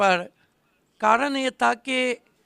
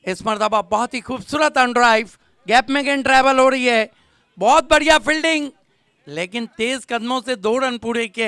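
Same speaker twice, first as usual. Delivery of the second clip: live play-by-play; 3 semitones higher, 6 dB louder than usual. Real, not acted. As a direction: follow the ball, rising into loud praise. (जोशीली cricket commentary, आवाज़ चढ़ती हुई)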